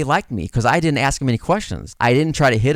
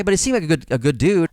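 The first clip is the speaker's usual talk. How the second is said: ordinary talk, nothing marked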